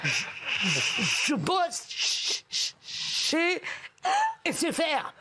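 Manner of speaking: very slow, high stammer on je and ch-; stuttering Charlie. Acted. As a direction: drag out the stutter until S F R comes. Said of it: "un mec qui bégaye".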